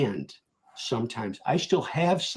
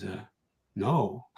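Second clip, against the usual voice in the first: softer, hesitant mock quiet answer; retold quiet no; 8 semitones lower, 7 dB quieter than usual. Trader caught out on the phone, quietly admitting no. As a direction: trail off into a small, sheepish no. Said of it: "he gets really quiet on the phone".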